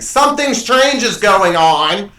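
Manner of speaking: loud, high, drawn-out announcement; mock alarm shout. Imagined somebody warning her with a frying pan, exaggerated slow shout. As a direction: blare it slowly like an obvious warning.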